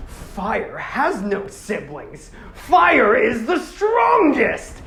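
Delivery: slow, building to a huge high shout; slow menacing words bursting into a yell; defiant villain roar. Retold or imitated. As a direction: drag it out then roar the last line. Villain's defiant quote in retelling.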